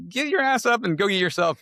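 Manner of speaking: high-pitched mock snap at lazy kids; retold exasperated order; not very nice. Father to lazy kids asking for water, mock exasperated. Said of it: "that wasn't very nice".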